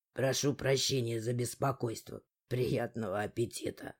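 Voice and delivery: polite words with a cold sneer; cold mockery. A narrator's reading. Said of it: "холодная насмешка". (холодная насмешка)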